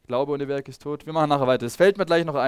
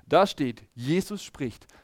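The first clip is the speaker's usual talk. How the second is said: ordinary delivery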